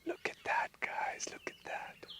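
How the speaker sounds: excited hushed whisper. Real, not acted. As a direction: whisper it to the viewers. Whispering to viewers near elephant.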